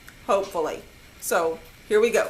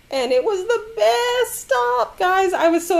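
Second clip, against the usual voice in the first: excited; 10 semitones higher than usual. Real, not acted. Excited about Kroger haul.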